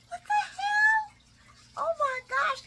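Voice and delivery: high, slow, wailing disbelief; high, drawn-out anguished what the hell; shocked cry. Real, not acted. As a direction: wail it in shocked outrage. Shocked at no prenup.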